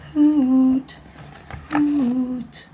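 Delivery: a hint of an owl calling softly, not bravely; timid call. Shy owl calling softly for his lost friend. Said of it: "He called out softly, but not very bravely".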